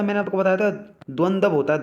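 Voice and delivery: usual speaking voice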